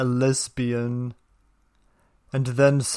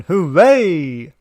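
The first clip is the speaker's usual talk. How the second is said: sarcastic cheer; 5 semitones higher, 8 dB louder than usual. Acted.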